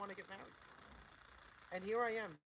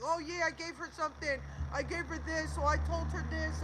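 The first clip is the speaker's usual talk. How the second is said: higher-pitched mocking quote of liars; mocking imitation; 5 semitones higher, 5 dB louder than usual. Woman retelling her opponents' lies, mocking imitation. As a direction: mimic their false claims, pitched up.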